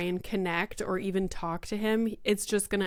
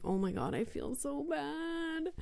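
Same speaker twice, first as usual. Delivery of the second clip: pitying; 6 semitones higher, 8 dB quieter than usual. Retold or imitated. Mother retells pity at child crying.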